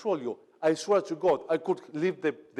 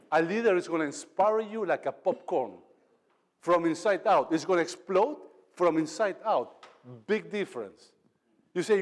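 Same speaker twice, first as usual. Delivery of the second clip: usual speaking voice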